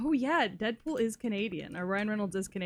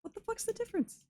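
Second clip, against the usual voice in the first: incredulous squeak. Real, not acted. Incredulous at maple syrup claim.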